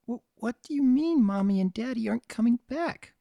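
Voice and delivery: high, trembling little filly; a scared child asking, voice breaking; shaky foal voice. A narrator's reading. Pumpkin asks Pinkie about her parents, shaky and scared. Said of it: "her voice shaky".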